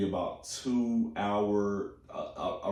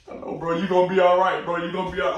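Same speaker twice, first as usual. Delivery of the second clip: high, loud, choked-up comforting, fast; pitched-up, loud teary reassurance; emotional consoling; 9 semitones higher, 8 dB louder, faster than usual. Retold or imitated. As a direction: console the crying kid while tearing up yourself. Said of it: "I was like".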